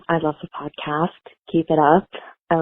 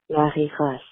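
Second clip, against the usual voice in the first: Marie Grace in a French accent, uvular r, stress at the end; 4 dB quieter than usual. Shown as a demonstration. Listener showing the French name, French accent. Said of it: "if you're saying her name with a French accent".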